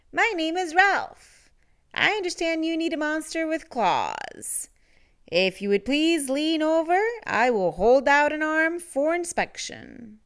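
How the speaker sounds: a fussy, elegant monster; high silky monster voice. A narrator's reading. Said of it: "in a high, silky voice".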